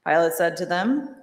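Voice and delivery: ordinary delivery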